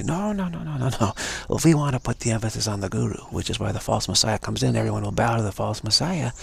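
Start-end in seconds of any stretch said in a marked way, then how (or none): 0.0-1.1 mock protest. People refusing the emphasis.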